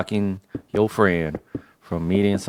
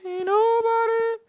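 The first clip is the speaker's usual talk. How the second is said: high mocking retort.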